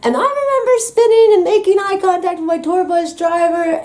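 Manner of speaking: high, gleeful retelling; excited. Retold or imitated. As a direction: relive the giddy spin. Repeats spinning line, higher, excited.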